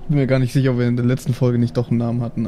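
ordinary delivery

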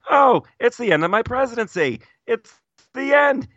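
high, squeaky put-on voice; Pee Wee Herman voice. Retelling the quote to a friend, high squeaky Pee Wee voice. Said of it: "in the voice of Pee Wee Herman".

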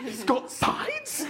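high, slow, disbelieving; astonished. Retold or imitated. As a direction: stretch it out in amazement. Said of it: "and I went".

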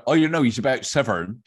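put-on chatty child slipping into the Gloucestershire twang; a light local Gloucestershire accent on Severn; mock local accent. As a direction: kid saying Severn with a local R. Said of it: "If we said".

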